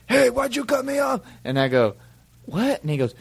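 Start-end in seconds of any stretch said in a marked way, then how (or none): 0.1-1.2 high-pitched mock yell of an angry driver; imitated yelling. Angry driver yelling through his window at [name], mock yell. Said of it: "yelling at me through his window". 2.5-2.8 mock puzzled. Acted reply to yelling driver.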